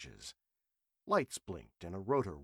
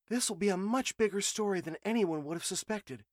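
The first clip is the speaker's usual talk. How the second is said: excited reporter; 10 semitones higher, 4 dB louder than usual. A narrator's reading. Emily thrilled about story.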